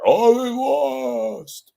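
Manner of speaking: high, slow, drawn-out mock wail; mock lament. Mocking sad fans after the loss, high drawn-out wail. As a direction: ham up the heartbroken fan. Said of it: "the whole town goes to hell".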